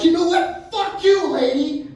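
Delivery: loud, high-pitched angry yell; exasperated waiter exploding; furious snap. Retold or imitated. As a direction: lose your patience and shout her down. Waiter snaps at the woman.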